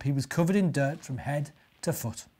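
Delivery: usual speaking voice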